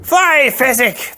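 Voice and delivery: very high, loud scream. Acted. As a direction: scream it up at him. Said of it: "the Sicilian screamed".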